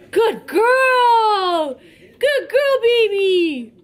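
high, loud squeaky praise; squeaky praising a dog. Real, not acted. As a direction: gush over the puppy, way up high.

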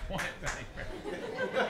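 squeaky high mock surprise; high falsetto mock "What Italian people?"; mock high disbelief. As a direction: ask it in a tiny high voice, pretending. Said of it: "mock reply".

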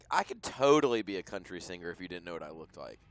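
ordinary talk

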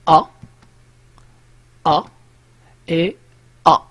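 each "أ" said alike, as a separate open "a"; identical pronunciation. Shown as a demonstration. Teacher shows learners three homophones, identical pronunciation of each "أ". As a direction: give every "أ" the same sound, with pauses between. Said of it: "عندهم بثلاثة بهم نفس النطق".

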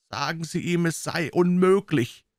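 a weak complaining voice from inside; plaintive weak voice. A narrator's reading. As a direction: hidden man refusing through the door, plaintive and weak. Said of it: "erwiderte eine klagende, schwache Stimme".

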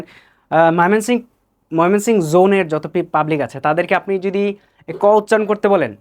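normal talking voice

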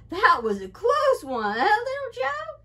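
high, loud relieved chuckle; laughing. A narrator's reading. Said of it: "he said, laughing".

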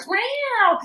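squealing guitar imitation, very high; mock guitar meow. A guitar player mimicking his guitar's squeal. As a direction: make the guitar wail like a cat.

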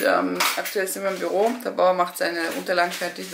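ordinary delivery

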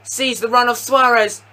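usual speaking voice

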